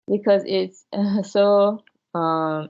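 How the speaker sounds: usual speaking voice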